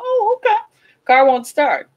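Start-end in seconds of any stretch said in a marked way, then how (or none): 0.0-0.6 high sarcastic "Oh, okay"; exaggerated high-pitched mock okay; mock surprise. Mocking daughter needing car.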